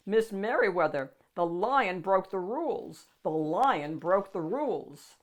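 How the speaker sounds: loud, urgent call down the hall. A narrator's reading. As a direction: shout the news while hurrying. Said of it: "he called as he walked".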